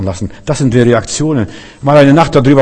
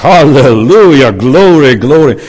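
jubelnder Ruf; 2 semitones higher, 6 dB louder, slower than usual. Acted. David jubelt vor dem Kampf.

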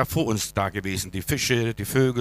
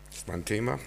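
ordinary talk

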